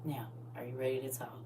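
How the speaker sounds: low, hushed question; calm whisper. Shown as a demonstration. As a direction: whisper it to quiet someone down. Demonstrates whisper trick on a yeller.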